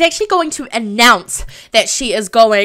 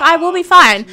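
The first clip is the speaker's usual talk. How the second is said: wife's retort; 7 semitones higher, 7 dB louder than usual. Retold or imitated. Wife snaps back at controlling husband.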